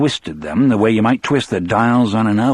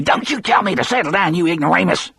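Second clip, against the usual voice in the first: high, indignant yell; Percy yelling; 8 semitones higher, faster than usual. Acted. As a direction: spit it out high and offended. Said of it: "Percy yelled".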